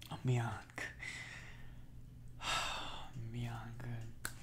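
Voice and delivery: very slow, breathy whispered; choked whisper. Real, not acted. Overwhelmed on the phone with partner, a slow whisper. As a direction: barely get it out.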